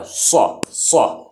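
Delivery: صا said slowly, thick and heavy from the throat; heavy saad sound. Shown as a demonstration. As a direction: draw out a heavy emphatic صا. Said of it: "اور یہ موٹا پڑا جاتا ہے".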